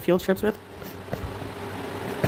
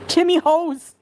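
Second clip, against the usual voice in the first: playful Canadian accent; 7 semitones higher, 3 dB louder, much slower than usual. Real, not acted. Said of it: "My Canadian accent has come out more".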